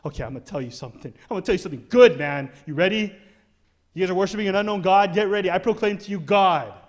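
loud, fast, fired-up preacher announcing big news; Paul hyped up. Retold or imitated. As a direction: play Paul bursting to tell them. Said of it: "So he's like".

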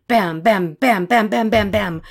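punched, rhythmic gun bangs; voiced machine-gun burst; imitated gunfire. A narrator's reading. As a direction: fire off the bams like rounds.

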